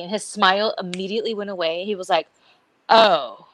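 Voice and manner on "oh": mock annoyed waiter. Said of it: "he got, like, mad at me".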